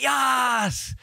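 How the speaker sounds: high, drawn-out mock celebration; long, high victory cry; triumphant cheer. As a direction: cheer it like crossing the line. Acts out celebrating his personal best.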